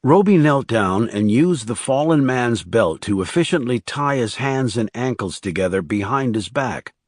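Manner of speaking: plain reading voice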